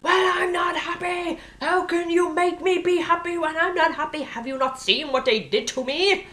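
high-pitched, indignant tantrum at God; angry protest. Acted. Speaker to God over being told to be happy, angry protest. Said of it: "I'd get so angry".

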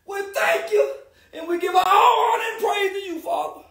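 high, crying shouts to the Lord; ecstatic praise. Real, not acted. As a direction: shout thanks to God through tears.

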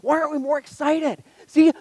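usual speaking voice

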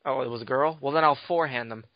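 normal talking voice

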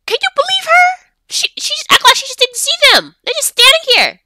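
very high, loud scandalised voice; hammed-up shocked gossip; mock outrage. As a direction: squeal your disbelief at the useless principal. Outraged at the principal ignoring students.

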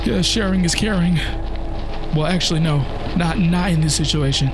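real panic. Real, not acted. Player panicking while the monster chases him, high frightened yelps. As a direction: yelp in fright as it closes in.

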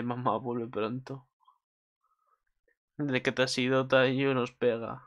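put-on soft, slow child's voice; a hurt little kid begging mom; quiet, slow plea of a child. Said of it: "en plan de".

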